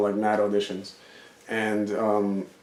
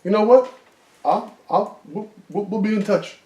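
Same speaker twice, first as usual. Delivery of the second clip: much higher, flustered, backing off; scared director; 11 semitones higher, 5 dB louder than usual. Retold or imitated. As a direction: rush to calm him down, rattled. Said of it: "He felt the intensity and he didn't wanna get beat up".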